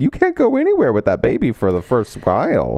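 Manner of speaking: usual speaking voice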